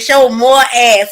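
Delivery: hammed-up greedy husband; eager husband. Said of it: "He said".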